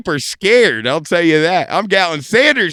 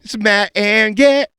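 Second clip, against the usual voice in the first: show intro; 6 semitones higher, slower than usual. Acted. Show name announced as catchphrase.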